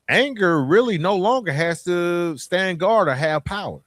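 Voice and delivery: normal talking voice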